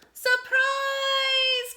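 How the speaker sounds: high, loud joyful shout; spring the surprise. A narrator's reading. Animals greet Little Hedgehog with his new nest, loud joyful shout. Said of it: "came the cry".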